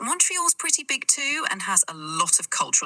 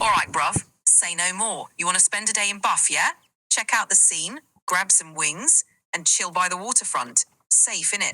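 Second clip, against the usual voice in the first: casual London roadman; laid-back British street accent. Shown as a demonstration. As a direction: talk like a UK roadman. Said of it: "like a roadman accent from the U K".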